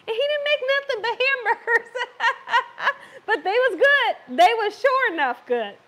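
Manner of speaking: giggling while teasing her husband; laughing. Real, not acted. To viewers about her husband's greasy stove, laughing.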